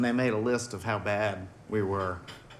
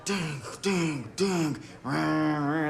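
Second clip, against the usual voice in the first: sung-out ding ding rah, pitched higher; mock soundcheck; 6 semitones higher, much slower than usual. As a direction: mimic a band's soundcheck noises. Imitating band soundcheck noises.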